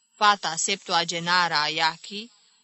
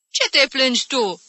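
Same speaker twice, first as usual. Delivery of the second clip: loud, high, cross rebuke; snapping; 7 semitones higher, 5 dB louder than usual. Acted. Aunt cutting off another's whining, snapping. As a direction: snap at the complainer. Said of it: "se răsti tanti Caterina".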